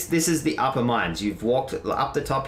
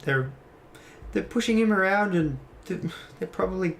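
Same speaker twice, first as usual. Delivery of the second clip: higher, hesitant worried voice; anxious, halting higher-pitched character voice; worried captive's ally; 8 semitones higher than usual. Acted. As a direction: stammer out your fear for him, pitched up. Plays the worried character Nezriel.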